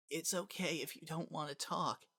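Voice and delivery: put-on a frightened little boy barely speaking; weak small child. Azriel comforts his friend.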